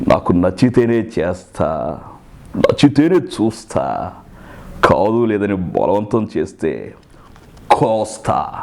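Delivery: low, slow, measured hero; Chiranjeevi, changed voice. Shown as a demonstration. Mimic showing the hero's newer voice, slow low threat. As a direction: spread the phrases out low with heavy pauses. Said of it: "అప్పుడు ఆ voice మారింది".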